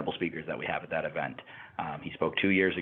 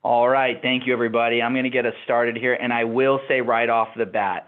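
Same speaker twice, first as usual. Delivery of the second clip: louder, higher, upbeat opening; energetic host; 5 semitones higher, 9 dB louder than usual. Real, not acted. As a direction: kick it off with energy. Kicking off the webinar.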